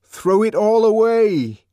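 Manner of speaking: high, loud, slow shout; full-voiced exhortation; piercing cry. A narrator's reading. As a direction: urge the crowd with drawn-out force. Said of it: "pierced through the enfolding layers".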